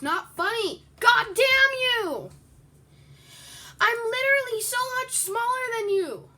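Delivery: high, loud angry outburst; real furious yelling. Real, not acted. Being chased by a bigger player, yelling furiously. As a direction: yell in real rage.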